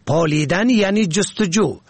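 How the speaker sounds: ordinary talk, nothing marked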